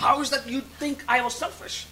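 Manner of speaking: high-pitched challenge to God; retold indignant protest. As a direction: question the accusation, pitched well up. Retelling his argument with God. (nachgespielter, empörter Einwand)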